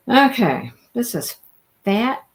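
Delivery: ordinary talk, nothing marked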